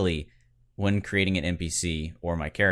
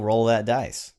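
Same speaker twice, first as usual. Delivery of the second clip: a playful hammed-up yell; egging the host on with a mock shout; 5 semitones higher, 6 dB louder, slower than usual. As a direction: belt it out like a game call, half joking. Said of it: "get you to yell".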